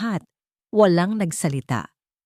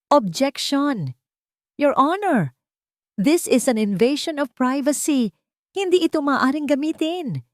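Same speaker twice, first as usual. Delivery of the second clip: desperate shout; 9 semitones higher than usual. A narrator's reading. Cornered lawyer shouting to stop the evidence, desperate and high. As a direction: yell it like the case is slipping away. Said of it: "Desperadong sigaw ng abogado".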